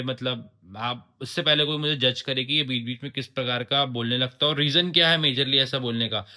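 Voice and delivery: normal talking voice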